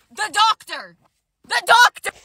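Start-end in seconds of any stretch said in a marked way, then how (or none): 1.4-2.1 higher and louder, rising in disbelief; shocked realization. Real, not acted. Telling viewers who was at the window, shocked and louder. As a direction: hit it like a shocking reveal. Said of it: "repeat for punch".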